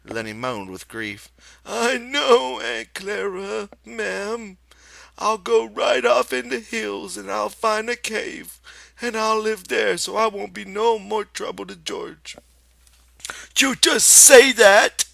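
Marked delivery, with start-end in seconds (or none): 1.6-12.4 put-on high, loud childlike big man; Lenny's voice. Lenny promises Aunt Clara to leave, high childlike plea. 13.6-15.1 high, loud, slow sharp retort. Acted. Aunt Clara snaps at Lenny, loud and sharp. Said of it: "she said sharply".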